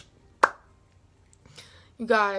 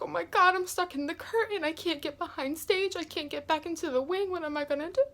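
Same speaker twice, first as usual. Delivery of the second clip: higher, softer voice imitating panicked crying; mock near-tears, pitched up; retold tearful panic; 6 semitones higher, 4 dB quieter than usual. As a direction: replay a small, tearful fret. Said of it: "and I started crying".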